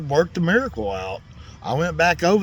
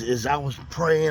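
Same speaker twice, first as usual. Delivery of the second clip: ordinary talk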